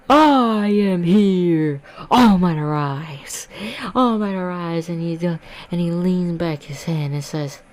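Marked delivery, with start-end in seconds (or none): 0.1-4.9 loud, slow booming hero entrance; bellowed heroic proclamation; heroic shout. Retold or imitated. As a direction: announce the hero's arrival to the crowd. Hero's big entrance.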